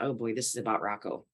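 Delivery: hushed realization about the gift; quiet inner dread. Retold or imitated. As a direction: think it aloud softly, dreading. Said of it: "I thought".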